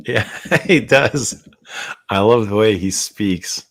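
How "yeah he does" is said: laughing. Real, not acted. Laughing about Remy's French accent.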